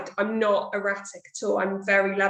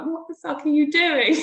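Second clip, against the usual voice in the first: laughing mock outrage; 7 semitones higher, 4 dB louder than usual. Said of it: "be like".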